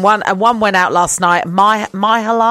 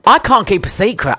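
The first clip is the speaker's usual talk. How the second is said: ordinary talk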